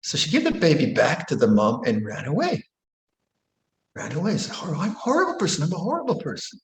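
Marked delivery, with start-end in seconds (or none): 4.6-6.6 higher, quick distressed self-blame; mild self-reproach. Retold or imitated. Grandmother calling herself horrible, quick and distressed. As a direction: blurt out the shame.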